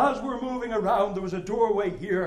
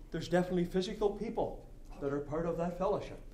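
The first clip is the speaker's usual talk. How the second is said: normal talking voice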